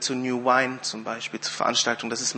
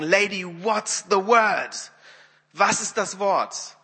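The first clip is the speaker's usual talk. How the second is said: slow, higher, emphatic demand; very stern; 4 semitones higher, slower than usual. Retold or imitated. Wimber fed up with the weeping woman, very stern. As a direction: demand it sternly, stretching every word. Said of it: "sagte er so ganz streng".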